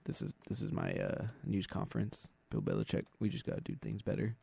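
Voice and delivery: low, very soft monotone; robotic, subdued coach impression; Bill Belichick. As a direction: drone through a press conference with no emotion. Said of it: "He talks so mo-, monotone".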